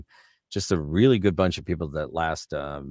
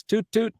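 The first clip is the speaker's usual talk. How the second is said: imitated horn toot; 3 dB louder than usual.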